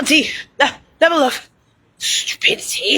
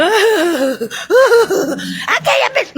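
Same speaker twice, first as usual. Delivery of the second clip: higher, hammed-up fake whimper; mock crying; 7 semitones higher than usual. Mocking someone who cries, hammed-up fake sob. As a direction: fake a sobbing whine, much higher. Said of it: "I'm gonna start crying like you".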